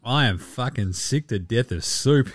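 normal talking voice

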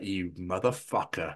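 hushed curse. Retold or imitated. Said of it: "I was like".

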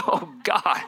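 ordinary talk, nothing marked

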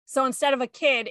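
ordinary talk, nothing marked

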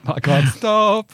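can barely get it out for laughing; laughing fit. Real, not acted.

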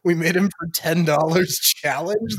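ordinary delivery

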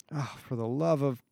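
exasperated groan. A narrator's reading. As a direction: groan it out, fed up. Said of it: "Barrett groaned".

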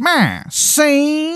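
high, slow wiseguy drawl; old-timey gangster introducing himself; 1920s gangster. Acted. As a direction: cactus gangster saying his name. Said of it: "He goes".

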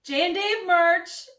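loud, high, stretched-out merch plug; over-the-top shout-out on request; promo plug. Shown as a demonstration. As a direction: sing it out big and slow to the camera. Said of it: "look into that camera, that camera and say".

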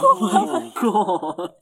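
loud, slow "oh my God" through laughter; laughing shock. Real, not acted. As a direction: burst out laughing, appalled. Listener reacts to scary lion habit.

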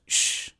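long hushing "shh"; a drawn-out hush for secrecy; shushing. Retold or imitated. Grandfather shushing grandson after sneaking his wine, hushed shush. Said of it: "He goes".

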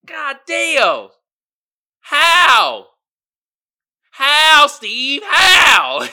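high, loud yelling How at Steve; exasperated shout. Real, not acted. Exasperated shout at Steve over the absurd answers. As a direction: shout it in outraged disbelief.

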